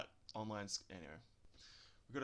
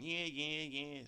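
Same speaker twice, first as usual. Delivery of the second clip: mock Aussie; 5 semitones higher, much slower than usual. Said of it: "I don't walk around going".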